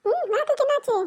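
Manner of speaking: put-on high, loud child's voice in alarm; high, frightened child squealing over her tongue; panicked little girl. Child voice held through the tongue prank.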